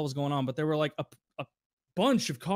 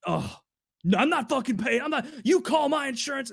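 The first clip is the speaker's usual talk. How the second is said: higher, indignant imitation of a customer; mock angry refusal; 9 semitones higher, 3 dB louder than usual. Customer refusing to pay the rental, mock indignant. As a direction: refuse like you're outraged. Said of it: "It's like".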